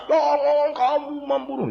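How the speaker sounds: high, slow loud repeat. Acted. Villagers echo the commandment loudly.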